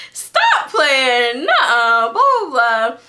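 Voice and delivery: loud, high mock disbelief from mom; quoting mom's loud stop playing; disbelieving mom. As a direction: brush it off loudly like a doubting mom. Mom's disbelieving reply retold.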